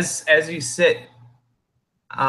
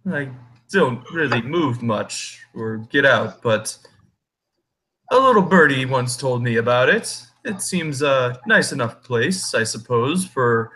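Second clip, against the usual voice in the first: talking tree. Acted. GM voices the tree.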